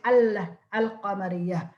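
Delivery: al-qamariya with the l of al fully heard; clearly sounded lam. Shown as a demonstration. Teacher repeating what students can say, sounded lam. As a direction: hold the l, then say al-qamariya. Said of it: "you can pronounce the al".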